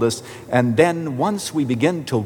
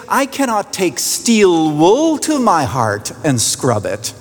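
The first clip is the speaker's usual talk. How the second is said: higher, slow, swooping imitation; emphatic woman caller; 5 semitones higher, slower than usual. Woman protests to her pastor, imitated slow emphatic plea. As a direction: draw it out with big rises, as the old lady.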